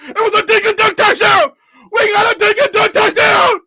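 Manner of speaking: high, loud touchdown celebration; ecstatic screaming. Real, not acted. Streamer celebrating his player's first touchdown.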